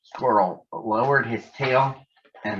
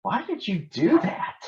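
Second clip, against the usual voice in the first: high, startled question after a jump scare; 10 semitones higher than usual. Acted. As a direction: yelp it out, caught off guard. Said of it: "Nittany Lion jumped".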